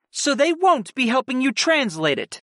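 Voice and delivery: a hint of haughty disbelief; arrogant. A narrator's reading. Aunt doubting her nephew, lightly arrogant question. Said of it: "with an arrogant raise of her eyebrow".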